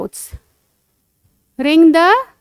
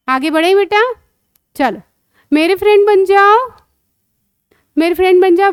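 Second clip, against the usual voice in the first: ordinary delivery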